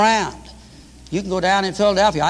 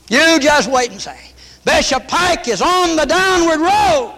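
full-force preaching; 8 semitones higher than usual. Real, not acted. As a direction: thunder the prophecy at the crowd. Prophesying against Bishop Pike.

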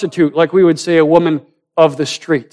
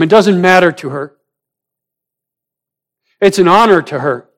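higher-pitched, driving sermon delivery; impassioned preaching; 6 semitones higher, 6 dB louder than usual. Real, not acted. Preacher relives the woman's anguish for congregation, impassioned. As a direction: preach it with urgent, pressing intensity.

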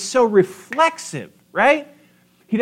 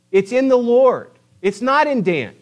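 ordinary talk

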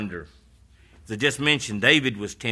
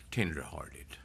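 soft, lower tenderhearted; hushed tenderhearted; 8 semitones lower, 12 dB quieter than usual. Acted. Repeated, hushed.

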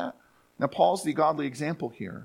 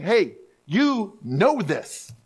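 very slow, higher, pointed Hey, you know this; Paul nudging Timothy; 5 semitones higher, 3 dB louder, much slower than usual. Retold or imitated. Paul reminds Timothy, slow and pointed. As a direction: lean in like a mentor reminding a student. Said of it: "it's kind of like saying".

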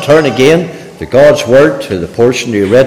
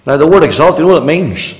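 ordinary talk

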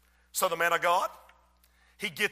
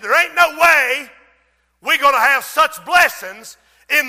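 loud, raised-pitch disbelief, piling up each phrase; scoffing; 5 semitones higher, 11 dB louder than usual. Acted. The king's lord ridicules the man of God, loud scoffing. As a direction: scoff at full preaching volume, quickening through the list. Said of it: "he's scoffing. He's ridiculing".

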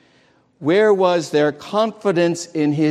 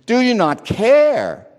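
higher, louder reproachful cry; accusing; 7 semitones higher, 3 dB louder than usual. Retold or imitated. Disciples waking the Lord in the storm, accusing. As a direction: throw the question at him as a reproach. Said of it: "they were accusing him".

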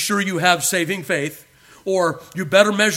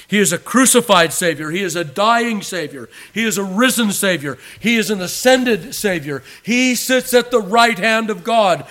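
rising, louder with each Savior line; preacher climbing to a loud proclamation; preaching crescendo; 5 semitones higher, 6 dB louder than usual. Acted. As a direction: build fervor line by line. Builds a list of titles.

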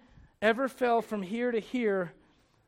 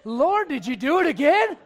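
higher and louder, laughing at God; laughing mock prayer; 6 semitones higher, 9 dB louder than usual. Preacher jokingly asks the Lord, laughing loudly. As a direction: toss it up to the Lord through a laugh.

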